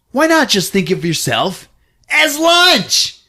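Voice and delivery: high taunt building to a yell; hammed-up monster taunt; mock villain shout. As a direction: punch the last phrase high and loud.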